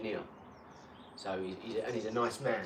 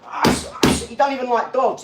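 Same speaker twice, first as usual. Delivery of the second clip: exasperated outburst; 8 semitones higher, 8 dB louder than usual. Retold or imitated. Mocking the cousin's dog.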